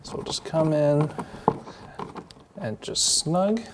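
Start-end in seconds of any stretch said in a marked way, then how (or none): 2.6-3.6 snug drawn out high and slow; "snug" said slowly and raised for emphasis; stress on snug. Shown as a demonstration. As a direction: lean on "snug", stretched and lifted. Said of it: "I wanna use the emphasis on the word snug".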